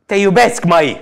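loud, high yell of love; mock yelling. Imitating the man's partner declaring love, yelling. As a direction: yell it like an unstable lover. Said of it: "Știi că ea și urla".

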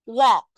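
"Walk" said loud with a round open O and the l silent; pronunciation model. Shown as a demonstration. Teacher modelling "Walk" loudly for the class. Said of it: "Âm O".

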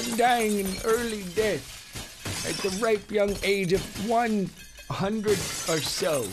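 a hint of a creaky old man. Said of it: "that was the old man".

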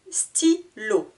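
"Stylo" as Sty... lo, with a gap; split into syllables. Shown as a demonstration. Teacher counting two syllables, "Stylo" split Sty... lo. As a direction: cut "Stylo" into two beats. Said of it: "Deux syllabes".

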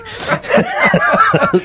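high-pitched laughter; real laugh. Real, not acted. Guest jokes about tanking his interview, real high laugh.

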